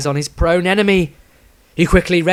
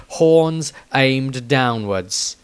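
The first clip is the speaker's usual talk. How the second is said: ordinary delivery, nothing marked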